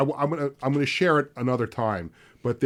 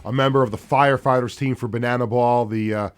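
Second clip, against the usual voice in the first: radio announcer; 8 dB louder than usual. Acted. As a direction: welcome listeners back like a big radio host. Radio host opening segment.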